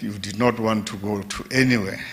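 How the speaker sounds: ordinary talk, nothing marked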